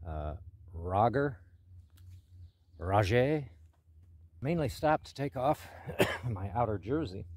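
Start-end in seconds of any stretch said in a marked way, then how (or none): 0.7-1.4 Roger as RAH-gher, slow and questioning; ah-vowel pronunciation. Shown as a demonstration. Hiker guessing at the mountain's name, drawn-out RAH-gher for Roger. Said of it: "I guess it's pronounced Rager". 2.8-3.5 Rajay as rah-ZHAY, loud and rising; French-style pronunciation. Shown as a demonstration. Hiker offering a last guess at the name, fancy rah-ZHAY for Rajay. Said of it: "I guess it's pronounced Rager".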